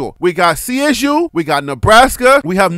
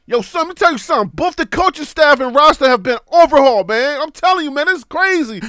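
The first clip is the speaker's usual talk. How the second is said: put-on Brooklyn accent; 3 semitones higher, 3 dB louder than usual.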